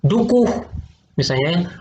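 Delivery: normal talking voice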